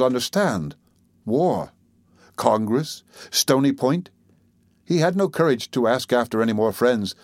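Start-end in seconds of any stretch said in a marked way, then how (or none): none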